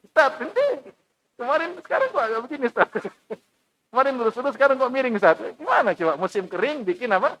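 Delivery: high, fast teasing voice; mocking villager. Villager teases the preacher for building a ship in drought, high and mocking. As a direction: needle the preacher who builds a boat. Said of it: "ada orang ustadz bikin kapal".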